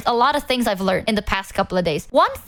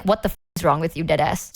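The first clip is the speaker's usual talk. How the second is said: fast, lower, blunt mom snapping; 7 semitones lower, faster than usual. Retold or imitated. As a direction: rattle it off like an unimpressed mother. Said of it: "My mom would be like".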